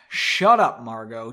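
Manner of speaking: understated angry dismissal; angry but quiet. A narrator's reading. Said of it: "angrily but quietly".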